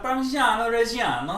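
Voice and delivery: exaggerated Italian pronunciation; Giada impression. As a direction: say it like a TV chef, rolled and Italian. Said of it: "should I do Giada".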